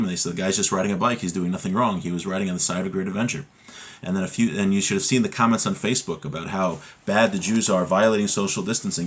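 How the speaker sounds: ordinary talk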